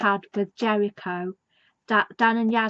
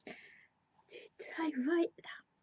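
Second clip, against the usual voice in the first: whispered, almost to herself; 6 semitones higher, 15 dB quieter than usual. Real, not acted. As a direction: whisper the question to yourself. Wondering to herself at the end.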